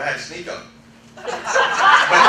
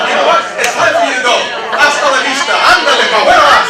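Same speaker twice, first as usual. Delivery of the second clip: loud, higher, playful send-off; mock dismissal; 6 semitones higher, 9 dB louder, faster than usual. Preacher playfully ordering negative people out, loud. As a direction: shoo them out with swagger.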